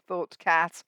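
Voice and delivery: plain reading voice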